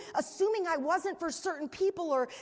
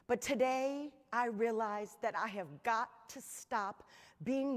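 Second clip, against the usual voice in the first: grieving mother, voice low; 4 semitones lower, 4 dB quieter than usual. Retold or imitated. Speaker retells a grieving mother's words, low and soft, near tears. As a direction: hold back tears while quoting her. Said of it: "She goes".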